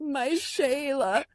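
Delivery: high, slow, shouted meme quote. Retold or imitated. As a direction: wail it out like the meme. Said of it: "I'm quoting".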